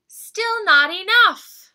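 high, eager cub voice; sweet little bear cub. Acted. Little Brown Bear's voice.

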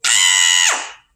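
short loud pretend scream; play-scared shout for the kids; mock scream. Teacher screams for the children, playful loud yelp. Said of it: "don't forget to scream".

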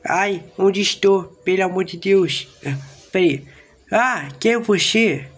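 high, hammed-up cries for help; a kid yelping as he's pulled up; playful fake alarm; mock panic. Kid being abducted by spaceship.

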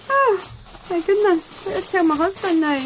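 barely believe it; telling viewers she'll show her husband, awed and excited; stunned excitement. Real, not acted. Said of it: "I'm so excited".

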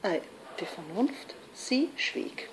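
very soft, questioning rises fading away; quiet, teasing poem ending; hushed punchline. Acted. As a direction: let it die down almost to a whisper. Hushed punchline as Reason falls silent.

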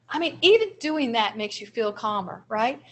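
usual speaking voice

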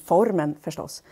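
ordinary talk

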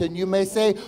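usual speaking voice

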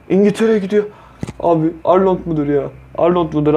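hopeful excited cry. Real, not acted. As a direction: voice jumps up with anticipation. Pack heads to England, hopes rise.

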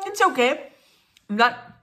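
speaking with food in mouth; talking while chewing. Real, not acted. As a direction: mouth partly full of dumpling. Seen 0: bit dumpling at -9s, still chewing while talking, bites again later.

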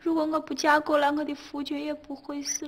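high, plaintive keening; woeful, self-pitying litany; self-blaming lament. Acted.

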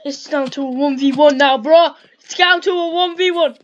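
loud, rising hype; hyped shout. Real, not acted. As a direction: pump it up loudly. Hyped final showdown.

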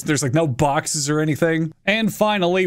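ordinary talk, nothing marked

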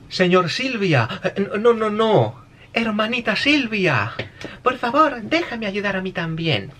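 high, drawn-out pleading voice; hammed-up girlish plea to big sister; personaje afeminado. Acts out his own jokey subtitle translation. (súplica aguda y afectada)

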